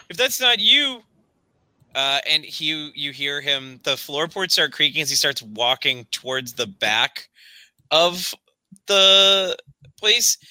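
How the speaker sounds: ordinary talk, nothing marked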